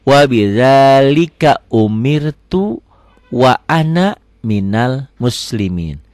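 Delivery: slow, drawn-out model recitation. Shown as a demonstration. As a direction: recite slowly, holding long vowels. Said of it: "perlahan ya".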